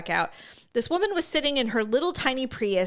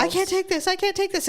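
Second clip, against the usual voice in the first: imitating grieving wail; 8 semitones higher than usual. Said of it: "she's like".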